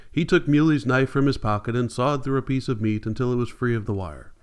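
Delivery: ordinary delivery, nothing marked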